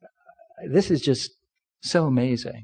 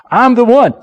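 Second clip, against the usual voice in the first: rising preacher's call, louder; congregation call-out; 7 semitones higher, 6 dB louder than usual. Shown as a demonstration. Preacher leading his church in a loud call-back. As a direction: call it out for the room to repeat. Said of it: "Say this with me".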